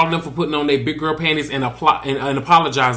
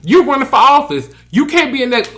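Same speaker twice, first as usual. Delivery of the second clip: mock scolding; 9 semitones higher, 4 dB louder than usual. [name] scolding Heavenly about cussing.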